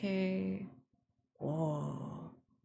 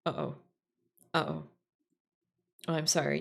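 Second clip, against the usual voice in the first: normal talking voice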